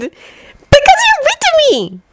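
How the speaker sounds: put-on very high, slow little girl; carefree drawn-out child voice; simple child reply. A narrator's reading. Kirimi answering Haruhi, very high carefree child voice. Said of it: "replied simply".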